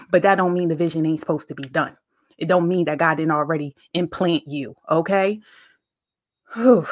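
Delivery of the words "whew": a loud, high whew before a hard memory; emotional exhale. Real, not acted. Bracing herself to tell her birthing story, emotional whew. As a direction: blow the feeling out before the story. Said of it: "I get a little emotional here".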